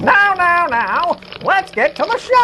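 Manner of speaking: skeleton voice. Acted. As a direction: cackle it out like a skull. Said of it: "That's awful".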